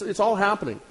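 usual speaking voice

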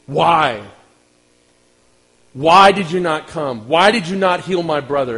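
mildly acted grieving sister's accusation; angry reproach. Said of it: "she literally lets Jesus have it".

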